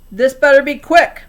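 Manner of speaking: grumpy man hurrying his visitors; impatient. A narrator's reading. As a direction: make it clear he wants them gone. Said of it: "Mister Lorenz said".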